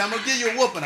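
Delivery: high, loud mock mama threat; mama threatening. Son retelling how his mama threatened a whooping. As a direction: play the angry mama warning her boy. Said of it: "She'd say".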